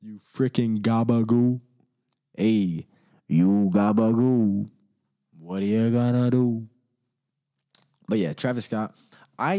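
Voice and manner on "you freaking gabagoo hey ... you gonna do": louder, slow mob tough-guy jab; mock tough guy. Teasing a hypocrite as a slow, louder wiseguy. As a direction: lean in like a wiseguy.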